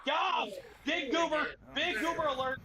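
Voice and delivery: high, loud alarm call; excited shout. Real, not acted. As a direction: yell out the alert. Spotting a boss, calling out.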